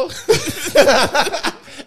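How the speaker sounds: loud high laughter; big laugh. Real, not acted. Storyteller laughing loudly. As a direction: laugh hard.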